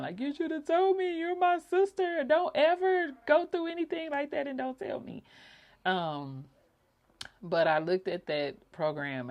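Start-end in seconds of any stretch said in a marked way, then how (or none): 0.2-5.2 high tearful plea, louder; crying plea. Retold or imitated. Crying to her sister on the bed, high and pleading. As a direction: beg through tears. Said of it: "And I was crying".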